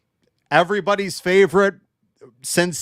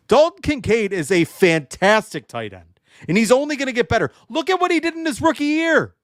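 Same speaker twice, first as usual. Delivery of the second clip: rising higher and faster, all worked up; fired-up hype; 7 semitones higher than usual. Real, not acted. Podcaster hyping Kincaid to fans, fired up and rising. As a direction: sell the tight end like it's gospel. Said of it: "I sound like I'm mad".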